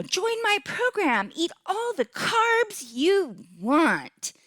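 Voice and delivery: high, drawn-out sales pitch, hammed up; mock Instagram promoter. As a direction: imitate a gushing online program plug, stretching the words. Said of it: "all these people on Instagram or whatever saying".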